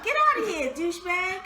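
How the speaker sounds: laughing at her friend's impression. Real, not acted. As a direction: toss it off through laughter. Laughing dismissal.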